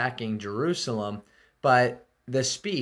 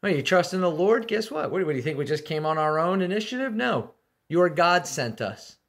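gloating taunt; 5 semitones higher than usual. Retold or imitated. Assyrian chief gloats to Judah's people, higher and jeering. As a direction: rub it in, mocking their faith. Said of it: "he goes on to say".

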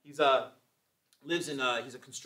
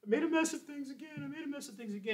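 high, soft, whiny self-pity; mock sniveling; 12 semitones higher, 5 dB quieter than usual. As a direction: snivel it like a hammed-up crybaby. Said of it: "just sniveling, sniveling".

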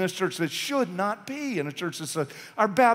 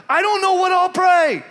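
nervous objector; 11 semitones higher, 8 dB louder than usual. Pretend. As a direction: play the scared churchgoer making excuses.

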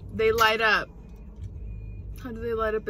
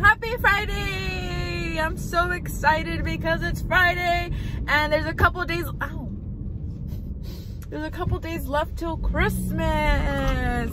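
excited greeting; 8 semitones higher, slower than usual. Real, not acted. Cheery greeting to viewers.

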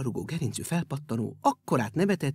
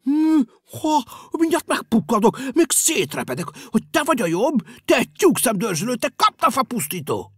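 loud, raised angry squawk; a shoe bursting with anger; angry quacking shoe; 6 semitones higher, 7 dB louder than usual. Acted. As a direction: sputter with rage like a furious shoe. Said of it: "hápogott mérgében".

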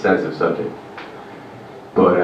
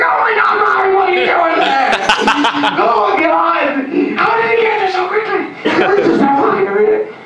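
shrieking fright. Retold or imitated. Parent finding Ryan suddenly behind them, shrieking. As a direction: scream in shock at the child appearing. Parent startled by sneaking child.

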